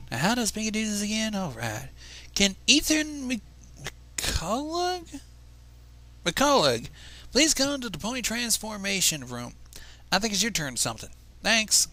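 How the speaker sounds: high, slow Southern announcer voice; southern drawl. Acted. Pony announcer calling Ethan to the transformation room, Southern drawl. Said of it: "in a noticeable southern drawl".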